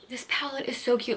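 soft unvoiced whisper; whispered gush. Real, not acted. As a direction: breathe it like a secret. Whispered admiration of palette.

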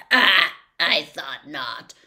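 slow, loud pleased croak; happy croak. A narrator's reading. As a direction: croak it with glee. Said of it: "said the voice happily".

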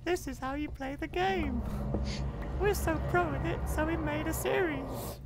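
high, slow YouTuber host voice; mock pro gamer tutorial voice; let's play voice. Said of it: "I don't even know why I'm talking like this".

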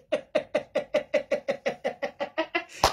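rattling staccato laugh that climbs; long cackle. Real, not acted. Teller tickled by self-love, long cackle. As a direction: laugh on and on in quick pulses.

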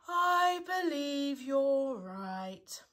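very slow, mournful admission; long sad sigh. A narrator's reading. Eeyore finding his tail is gone, sighing sadly. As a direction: draw every word out like a sad sigh. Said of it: "with a long and sad sigh".

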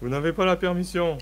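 yell. Acted. Captain yelling at Elowen at the helm. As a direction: bark the order at her. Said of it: "hurla-t-il".

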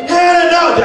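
high-pitched mock cry; alarmed protest. [name] stopping Hannah in a brawl, high mock protest. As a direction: call out in hammed-up alarm.

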